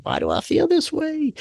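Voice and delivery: high, soft puzzled question to himself; baffled self-question. Retold or imitated. Asking himself at the fair why he feels off, high and soft. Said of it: "And I'm like".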